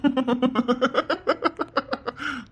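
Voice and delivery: long high giggling laugh; amused laugh. Acted. Boyfriend laughs at shy girlfriend on phone, giggling. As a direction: laugh off her shyness.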